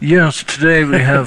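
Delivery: drawn-out aristocratic announcer; George Plimpton. Pretend. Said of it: "it has to be like George Plimpton".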